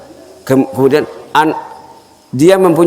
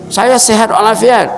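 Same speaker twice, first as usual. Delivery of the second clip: voiced host; 9 semitones higher than usual. Host insists he is healthy.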